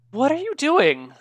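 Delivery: high, slow incredulous question; incredulous knight. Acted. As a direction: draw it out high, questioning what they're up to. Game master voices the suspicious knight.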